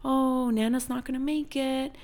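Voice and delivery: a hint of a gentle grandpa; old man. William telling grandkids Nana won't make it, lightly voiced. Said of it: "he was like".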